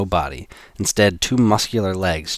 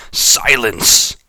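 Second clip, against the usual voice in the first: commanding; 4 semitones higher, 5 dB louder than usual. Acted. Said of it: "the Plutonium Boss cut him off".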